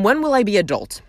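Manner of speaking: ordinary talk, nothing marked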